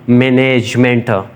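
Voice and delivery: Management drawn out slowly, syllable by syllable; slow management with each syllable held; syllable-split demo. Shown as a demonstration. Teacher showing learners syllable variation, management slowly syllable by syllable. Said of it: "ये तो variation नहीं".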